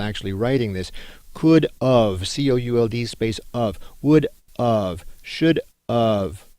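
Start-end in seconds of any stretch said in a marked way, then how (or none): 1.3-2.3 "Could of" with a full, separate OF; wrong form "could of". Shown as a demonstration. Teacher showing students' misspelling, deliberate "Could of". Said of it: "Those aren't words". 4.0-6.5 "would of, should of", each OF a full separate word; wrong forms with "of". Shown as a demonstration. Teacher showing a pet-peeve error, deliberately separated "of". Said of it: "Those aren't words".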